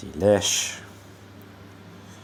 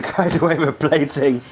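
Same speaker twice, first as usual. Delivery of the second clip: laughing; 7 semitones higher, 5 dB louder than usual. Real, not acted. Laughs at own forgetfulness.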